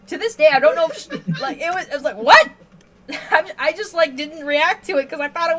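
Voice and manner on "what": a sudden loud, laughing what; loud laughing disbelief; startled what. Retold or imitated. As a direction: blurt it out in shock. Her baffled reaction.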